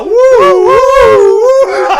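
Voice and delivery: very high, loud wailing siren noise; mock ambulance siren; siren imitation.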